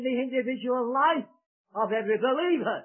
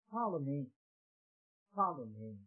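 low, softer follow me, echoed; hushed repeat; 7 semitones lower, 11 dB quieter than usual. Acted. Preacher softly echoing Jesus's call to his listeners, hushed. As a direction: let it fall to a hush.

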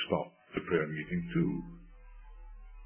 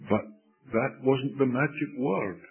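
normal talking voice

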